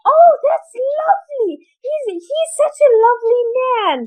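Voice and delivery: high, dreamy, gushing; gushing niece. Retold or imitated. Speaker praising the man to her aunt, high and gushing. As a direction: swoon over him innocently. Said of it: "I'm like".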